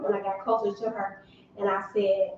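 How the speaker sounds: ordinary delivery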